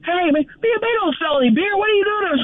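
high, complaining voice of a fooled fan; upset fan. Retold or imitated. Fooled fan back without beer, high upset whine. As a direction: whine it up high like he's been cheated. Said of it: "he's all upset".

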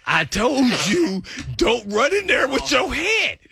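told-you-so shout; exasperated scolding. Retold or imitated. Scolding hurt teammate afterward.

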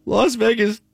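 high, trembling fake sob; mock crying. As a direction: whimper it like a fake mourner.

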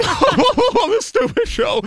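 very high, hammed-up blubbering; laughing while crying. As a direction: imitate sobbing through helpless laughter. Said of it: "laughing 'cause I'm crying so hard".

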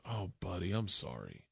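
a touch of a dad's quiet sympathy; mild pity. Retold or imitated. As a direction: break bad news gently to your son. Dad pities him on phone.

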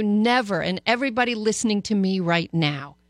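normal talking voice

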